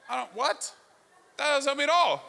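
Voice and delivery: high-pitched, exasperated confusion; mock bafflement. Nervous traveler gets a nonsense answer, comically baffled. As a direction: act thrown by a useless answer, voice jumping up.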